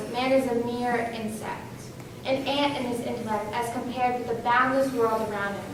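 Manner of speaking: ordinary talk, nothing marked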